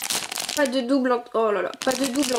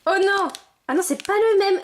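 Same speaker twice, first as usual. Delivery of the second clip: higher, dismayed cry; higher playful dismay; disappointed oh no; 6 semitones higher than usual. Real, not acted. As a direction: let the letdown show. Gets the wrong figurine.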